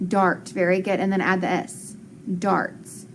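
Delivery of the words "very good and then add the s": ordinary delivery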